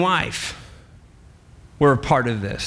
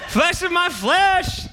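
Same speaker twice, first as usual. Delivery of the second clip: much higher, slow, laughing exclamation; overjoyed Adam; 12 semitones higher, slower than usual. Acted. Adam sees the woman and gushes with laughing joy. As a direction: stretch out the words in giddy delight.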